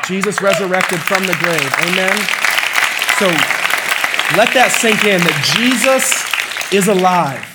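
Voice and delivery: loud, ringing declaration with drawn-out words; triumphant proclamation. Real, not acted. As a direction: proclaim it to the whole church. Preacher proclaims the resurrection.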